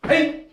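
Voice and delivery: sharp loud kiai yell; kiai shout. Real, not acted. Karate teacher shouting the kiai with students. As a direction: explode with a short battle cry.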